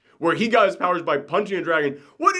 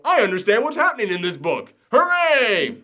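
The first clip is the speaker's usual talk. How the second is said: higher-pitched, exaggerated cheer; mock triumph; 6 semitones higher than usual. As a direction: celebrate like a proud simpleton, rising into a hooray. Mock cheer at understanding comic.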